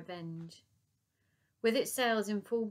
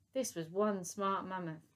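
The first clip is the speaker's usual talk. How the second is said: ordinary delivery, nothing marked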